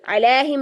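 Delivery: "अलैहिम" as "alaehim", glide missing; wrong flattened vowel. Shown as a demonstration. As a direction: leave the middle of "अलैहिम" as open ae. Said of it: "नहीं है".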